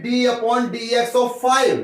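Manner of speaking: spelled out very slowly for notes; teacher dictating at writing speed; slow dictation. Shown as a demonstration. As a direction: say it slow enough to write down.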